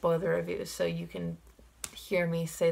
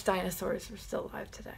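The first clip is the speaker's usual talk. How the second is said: a guilty little whisper of a wish; 9 dB quieter than usual. Real, not acted. Fan confessing a forbidden wish to Jeff Goldblum, whispered.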